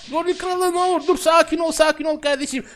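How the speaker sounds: panicked shout. Real, not acted. Gorilla king hits him in game.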